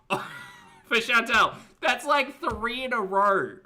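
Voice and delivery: disbelieving high-pitched cry; high excited squeal. Real, not acted. Squealing at repeated tower cards.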